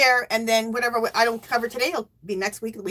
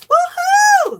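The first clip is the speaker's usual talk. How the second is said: high, loud whoop; cheering; 11 dB louder than usual. Real, not acted. Streamer celebrating a donation, cheering. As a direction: let out a big celebratory woohoo. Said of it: "I love when you cheer".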